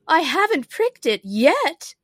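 high, loud, slow queenly complaint; wailing Queen. A narrator's reading. The Queen answers Alice about her finger, wailing. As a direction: draw it out as a distressed queen.

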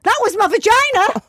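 high, loud blurted excuse; panicked cover-up. Retold or imitated. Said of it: "I just went".

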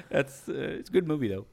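normal talking voice

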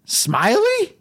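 very high, incredulous "Smiley"; shocked realization; stunned question. Retold or imitated. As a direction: squeak it in disbelief. Realizing who Joe was.

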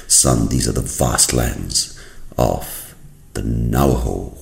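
very slow, hushed grand reveal; soft-voiced father surveying a vast view; solemn father. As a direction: draw it out quietly, with reverence. Father presents land to son.

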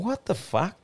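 high, hushed incredulity; retold disbelief. Podcaster retells the table reacting to a barging bloke, disbelieving. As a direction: pitch it up softly, baffled on the group's behalf.

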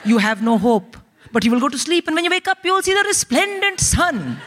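grandiose, philosophizing Indian father; erudite father. Acted. Father consoling his crying daughter, erudite and lofty. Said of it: "so my father's very erudite".

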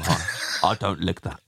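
silly low jokey delivery; mock pun. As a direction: play the "lick" pun with a laugh. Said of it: "silly pun voice".

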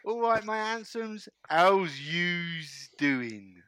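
drawn-out, hammed-up West Country greeting; West Country accent. Host trying out a greeting on the panel, West Country accent. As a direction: attempt a West Country accent, stretching the last words slowly. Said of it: "the West Country accent".

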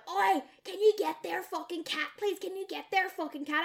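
high, mock-irate demand; imitated angry yell. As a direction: yell it like an annoyed neighbour. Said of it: "screaming at me".

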